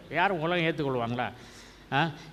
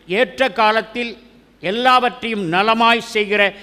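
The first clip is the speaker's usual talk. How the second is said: higher, louder ringing sermon delivery; full-force preaching; 5 semitones higher, 6 dB louder than usual. Acted. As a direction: preach it out to the congregation. Exhorts congregation.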